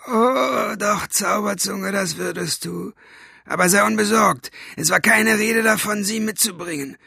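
high, mocking villain's voice; rough cat-tongue voice. A narrator's reading. Cat-voiced villain mocks and reassures. (hohe, höhnische Schurkenstimme)

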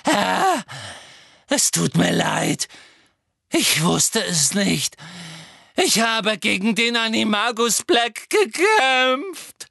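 high, slow, wailing plea; sobbing. A narrator's reading. Servant begs her master's forgiveness, sobbing. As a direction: sob the apology out, rising to a loud wail. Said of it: "schluchzte Bellatrix".

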